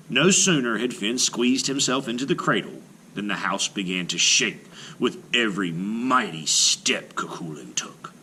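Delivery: ordinary delivery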